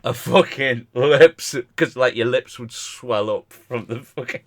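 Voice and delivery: ordinary talk, nothing marked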